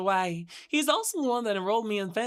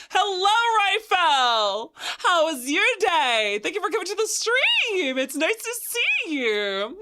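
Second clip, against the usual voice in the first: high bright welcome, slow; excited greeting; 8 semitones higher, 4 dB louder than usual. Real, not acted. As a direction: cheerfully greet a newcomer. Bubbly viewer greeting.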